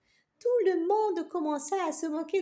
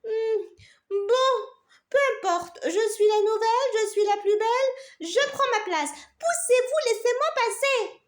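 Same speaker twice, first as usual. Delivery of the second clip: bossy; 8 semitones higher, 7 dB louder than usual. Acted. Vain carrot pushes in.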